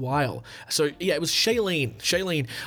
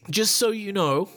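put-on Canadian accent; 6 semitones higher than usual. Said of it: "so I gotta do the accent".

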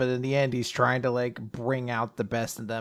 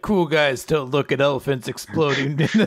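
louder, laughing through a deadpan cool line; laughing cool-guy line; 3 semitones higher, 7 dB louder than usual. Retold or imitated. Cohost quoting Luffy walking away, laughing. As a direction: try to stay cool but crack up laughing.

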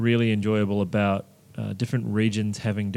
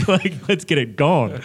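laughing; 5 semitones higher, 4 dB louder than usual. Real, not acted. Joking about smallpox comparison.

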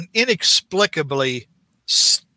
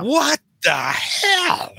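high, slow, incredulous cry; exasperated outburst; 9 semitones higher, much slower than usual. Real, not acted. Host reacts to the comic's dialogue in high disbelief. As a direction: drag it out in disbelief. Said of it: "nobody talks like this".

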